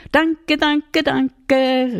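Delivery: hoch und laut jubelnd gerufen. A narrator's reading. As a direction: ruf es freudig in die Nacht. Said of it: "rief der kleine Hirte in die Nacht". (laut jubelnd gerufen)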